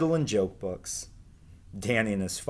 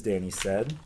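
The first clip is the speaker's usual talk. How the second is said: plain reading voice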